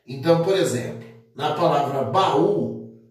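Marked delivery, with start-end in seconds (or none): none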